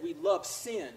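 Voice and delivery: ordinary delivery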